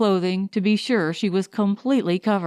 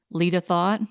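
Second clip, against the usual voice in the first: plain reading voice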